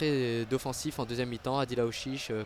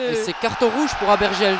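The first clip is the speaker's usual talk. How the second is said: loud, high shout; shouting red card; 6 semitones higher, 7 dB louder than usual. Real, not acted. (annonce criée du carton rouge)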